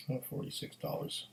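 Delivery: breathy whisper of disbelief. Real, not acted. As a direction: whisper the price, wincing at it. Repeats price, whispered in dismay.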